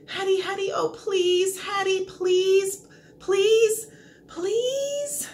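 high, drawn-out pleading whine; whining beg. A narrator's reading. Dog begging Hattie for food, whining and pleading. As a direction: beg pitifully, each please stretched longer. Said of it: "I whine some more".

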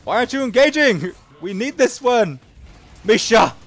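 loud, high exasperated shouting; frustrated yelling. Real, not acted. Yelling at teammates who won't engage, exasperated shout.

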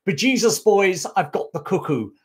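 a touch of an Irish soldier yelling; mock Irish battle cry. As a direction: toss off the sergeant's cry in a hint of Irish brogue. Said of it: "he allegedly shouted the great line".

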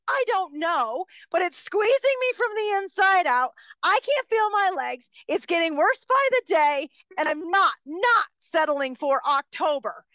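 high, strained, upset pleading; desperate patient screeching high at the scheduler; screeching howler monkey. Retold or imitated. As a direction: squeal it out like a frantic howler monkey. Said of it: "I'm gonna sound like a screeching howler monkey".

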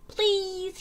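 high small fish voice; pleading little fish, pitched high; little blue fish. A narrator's reading. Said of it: "he said".

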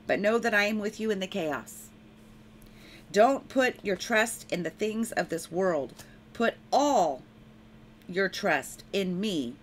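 proclaiming a prophecy in the Lord's voice; prophetic proclamation. Acted. Preacher speaks the Lord's warning to enemies, firm proclamation. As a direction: declare it with firm authority.

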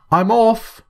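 bright call from the doorway; cheerful farewell called back into the house. A narrator's reading. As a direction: call it out high as you leave. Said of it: "called Quentin".

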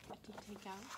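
plain reading voice

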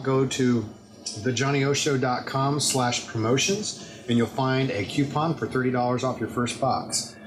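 ordinary talk, nothing marked

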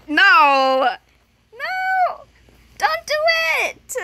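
high, drawn-out cries of no; pleading protest. Real, not acted. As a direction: plead loudly to stop your friend. Begs friend not to switch.

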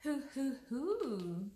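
playful animal hoot, last one held longer; Tiger's cry. A narrator's reading. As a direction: whoop like a bouncy storybook tiger. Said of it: "cried Tiger".

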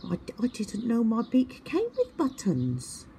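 high, puzzled and innocent; confused little owl voice. A narrator's reading. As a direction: stammer it in wonder. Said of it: "said Twit".

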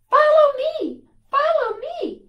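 high, loud, panicked shout; frantic high cry of alarm; urgent shout. A narrator's reading. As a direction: yell it to get her cousin running. Dogs burst in, Mira calls out.

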